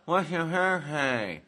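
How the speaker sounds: thick Southern hillbilly drawl; hillbilly accent. Retold or imitated. Cashier asking a stranger's birthday, Southern hillbilly accent. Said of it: "she was speaking hillbilly-ese".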